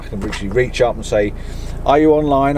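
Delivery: ordinary talk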